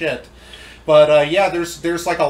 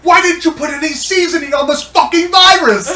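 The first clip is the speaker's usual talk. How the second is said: much higher, louder, slowed screaming chef with laughter; Gordon Ramsay impression; 11 semitones higher, 10 dB louder than usual. Friend yells at the virus as a TV chef, laughing. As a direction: berate it like a furious celebrity chef. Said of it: "Gordon Ramsay thinks of this".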